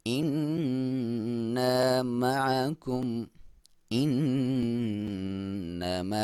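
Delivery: slow, drawn-out tajweed chant; slow Quran recitation. Shown as a demonstration. Teacher reciting the verse for students, slow and drawn out. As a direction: recite it slowly, stretching each vowel. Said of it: "नाक में से आवाज़ निकाल रहे होंगे".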